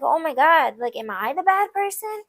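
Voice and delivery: mock-anxious second-guessing of yourself; worried self-doubt. Blamed partner wonders if they're at fault, worried. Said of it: "you start getting like".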